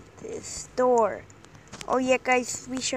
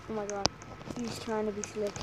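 ordinary talk

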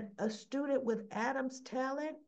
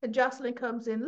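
ordinary talk

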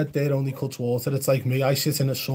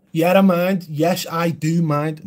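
loud, jokey fake refusal, then slower; mock refusal; 5 semitones higher, 6 dB louder, slower than usual. Streamer teasing a viewer who asked a question, mock-grumpy. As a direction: pretend to be annoyed, then drag it out. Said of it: "No, I'm joking".

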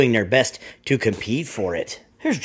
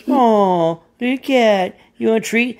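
higher, doting voice for a pet; sweet cooing Rosie; 9 semitones higher, slower than usual. Acted. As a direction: coo to the cat like talking to a baby. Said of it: "And she's like".

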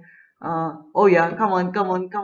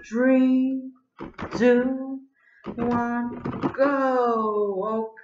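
high, eager count to go; excited countdown; 6 semitones higher, much slower than usual. Real, not acted. Starting round three, excited countdown. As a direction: count off the race start with energy.

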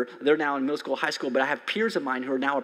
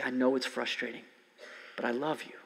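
tender comfort; 4 semitones lower, 6 dB quieter than usual. Retold or imitated. God comforting exhausted child.

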